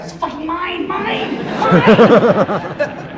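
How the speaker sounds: high-pitched, hammed-up territorial girl; possessive German girl. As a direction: play a mock-possessive young German lady. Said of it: "must have been German ... She'd be like".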